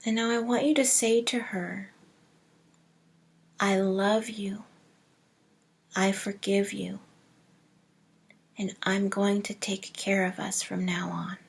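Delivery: soft, hushed meditation voice with long pauses; hushed, unhurried visualization, fading to near whisper; guided meditation. Acted. Leads a breathing meditation.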